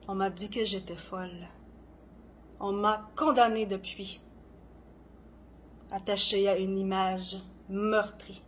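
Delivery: dramatic poetry reading with long pauses; poem recitation. Acted. Poet performing own poem on gentle madness, dramatic recitation. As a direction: recite each line deliberately, swelling and falling in intensity.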